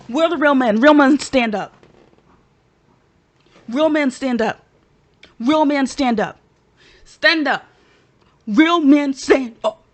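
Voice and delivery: worked-up shout, hammering each repeat; excited rallying cry. Real, not acted. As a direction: call all the real men to their feet. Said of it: "I got excited".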